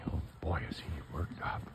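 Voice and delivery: usual speaking voice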